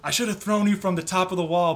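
a hint of the gruff wildling taunting Jon; Tormund quote. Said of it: "he goes".

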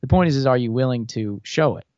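normal talking voice

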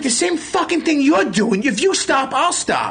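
high mimicked mother talking back; defiant mom voice. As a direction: throw it back at the son. Mother voiced in retold story.

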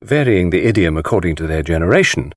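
ordinary delivery, nothing marked

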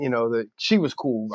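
plain reading voice

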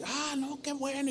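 high, hushed imitation of grandpa; pleased agreement. Grandfather accepts the invitation, imitated soft, high delight.

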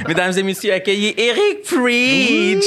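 arena announcer. Acted. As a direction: announce the guest like a boxing match. Host introduces the guest.

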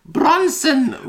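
high, loud call. Pretend. Calling out a character name.

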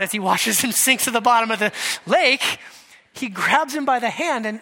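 normal talking voice

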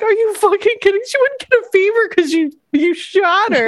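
high, angry outburst, only a mild yell; imitating an angry boyfriend; a hint of a furious yell, retold; mock yell. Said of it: "Josh just yelled at him".

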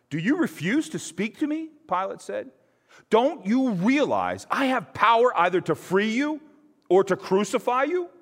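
high, loud, demanding challenge; indignant Pilate. A narrator's reading. Pilate demands an answer from silent Jesus, high and loud. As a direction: press him hard for an answer.

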